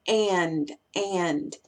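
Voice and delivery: "and" with a wide open A and final d; full "and". Shown as a demonstration. Teacher showing the effort it takes, wide-open "and". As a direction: open the mouth wide on "and", low. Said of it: "tengo que abrir mucho la boca".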